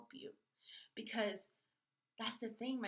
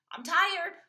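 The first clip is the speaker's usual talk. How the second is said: much louder, higher exasperation; exasperated cry; 9 semitones higher, 16 dB louder than usual. Retold or imitated. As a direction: burst out that you're tired. Complaining to God, exhausted.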